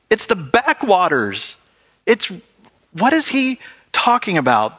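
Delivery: incredulous grumbling crowd. Retold or imitated. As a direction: scoff like a crowd that knows his family.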